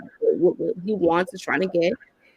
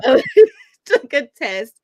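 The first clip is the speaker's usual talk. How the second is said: laughing; 7 semitones higher, 9 dB louder than usual. Real, not acted. Still laughing after joke.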